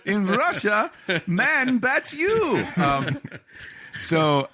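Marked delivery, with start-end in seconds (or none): 0.0-2.7 put-on high, very slow Russian-accent punchline; hammed-up Russian accent; mock Russian accent. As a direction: deliver it like a Russian comic. Russian reversal joke.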